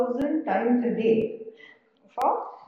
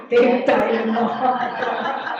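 laughing; 7 dB louder, much slower than usual. Acted. Joking quote of what people say.

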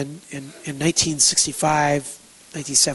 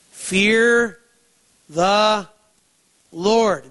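each word drawn out and set apart; slow, weighty proclamation; emphatic, word by word; 3 semitones higher, much slower than usual. Real, not acted. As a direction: land three words one at a time, with pauses. Announces the three-word message.

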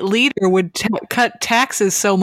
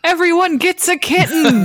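gleeful emperor; 6 semitones higher than usual. Pretend. Acting out Nero's bad idea.

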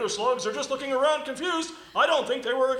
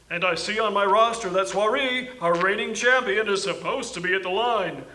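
sports announcer; 4 semitones lower than usual. Acted. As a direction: call the race like a broadcaster. Sports announcer for slug race.

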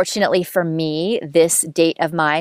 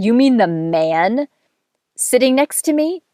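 slow, rising higher to a squeaky sitting next to me; incredulous retold question; 6 semitones higher than usual. Date asks if she means the man beside him, stretched and incredulous. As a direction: repeat his line, stretching it in disbelief. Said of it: "He said".

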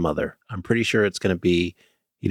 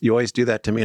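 normal talking voice

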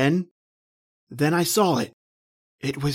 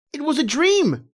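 relieved exclaim; 8 semitones higher than usual. Acted. Relief, repeating to himself.

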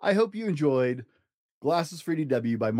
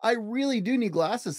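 put-on higher, pleading girl voice; insistent little girl; 8 semitones higher than usual. Little sister insisting to brother, higher pleading voice. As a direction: insist like a stubborn child.

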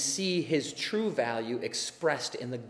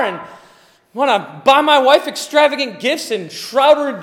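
loud, high preaching shout; preaching at full force; 12 semitones higher, 16 dB louder than usual. Real, not acted. Pastor shouting to the congregation about money and joy, full-force preaching.

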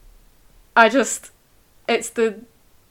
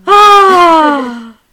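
sudden loud high cry; loud exclamation; 9 semitones higher, 15 dB louder than usual. Real, not acted. Reader breaking off from the novel, loud cry. Exasperated outburst after reading.

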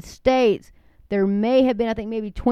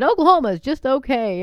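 mock slogan; 5 semitones higher, 6 dB louder than usual. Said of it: "My mom made fun of 'em".

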